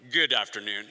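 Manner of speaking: very low pilot announcement voice; airline pilot. Acted. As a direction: drop way down into a mock captain's greeting. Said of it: "When the pilot gets on, he's like".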